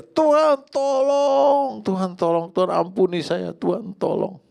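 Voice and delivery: full-voiced, high-pitched cry for help; shouting to God. Shown as a demonstration. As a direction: demonstrate a loud, pleading shout heavenward. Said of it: "Teriak kepadanya". (berteriak lantang memohon kepada Tuhan)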